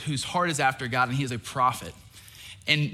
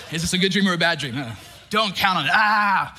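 louder, fast hammed-up fortune-teller voice; mock eight ball; 3 semitones higher, 6 dB louder than usual. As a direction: ham up a Magic 8 Ball's answers. Mock magic eight ball reading.

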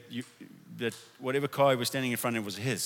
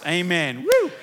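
exultant amen; 6 semitones higher, 9 dB louder, slower than usual. Real, not acted. Exultant close of declaration.

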